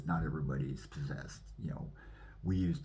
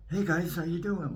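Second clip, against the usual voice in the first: high-pitched mimicked hello; casual greeting imitated; 12 semitones higher, 4 dB louder than usual. Man at the tree greeting the group, imitated casual hello. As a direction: call out a greeting, imitating the guy. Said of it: "Like that".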